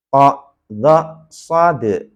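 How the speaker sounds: "Po, dho, sod" loud and separate, bouncing d on sod; letter names. Shown as a demonstration. Teacher models letter names, "sod" ending in a bounced de. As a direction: call out "sod" as so-de. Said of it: "ini dibaca nama hurufnya".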